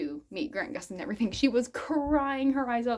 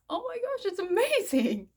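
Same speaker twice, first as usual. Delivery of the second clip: much higher, gushing amazement; a celebrity thrilled by a handmade jacket, mock high gushing; excited imitated reaction; 11 semitones higher, 4 dB louder than usual. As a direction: play his delighted surprise at the gift.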